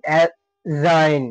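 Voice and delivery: অ্যাজাইন drawn out very slowly, no d; teacher modelling অ্যাজাইন; slow pronunciation demo. Shown as a demonstration. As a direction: stretch অ্যাজাইন syllable by syllable. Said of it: "অ্যাজাইন চলে আসছে".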